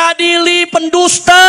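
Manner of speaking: high, slow mock slogan call; imitated protest cry. Preacher quoting people demanding trial for liars, mock yell. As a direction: draw it out like a protester calling, only mildly loud. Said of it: "Orang lagi teriak di mana-mana".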